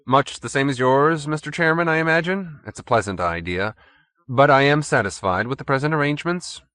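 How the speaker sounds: lightly voiced courteous rival. A narrator's reading. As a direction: answer with cool courtesy. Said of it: "Mister Parker was equally courteous".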